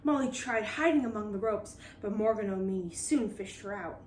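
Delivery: usual speaking voice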